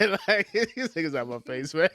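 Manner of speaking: laughing through the line; cracking up mid-sentence. Acted. Repeats punchline while cracking up.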